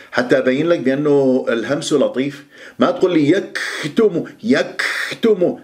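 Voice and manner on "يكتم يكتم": يكتم with a big breath puff after kaf; exaggerated hams. Shown as a demonstration. Teacher shows students the error, overblown kaf in يكتم. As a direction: overdo the airy release on k in يكتم. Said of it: "هذا مبالغ فيه".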